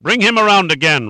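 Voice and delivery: high, loud, frenzied order; insane yell. A narrator's reading. Rondheim orders guards to rouse victim, insane yell. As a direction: yell it like a madman. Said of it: "Rondheim yelled insanely".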